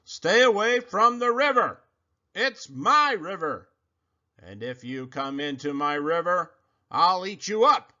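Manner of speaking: loud, high crocodile shout; a possessive crocodile roaring at the forest. A narrator's reading. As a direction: bellow a warning across the river. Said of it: "he shouted to the creatures".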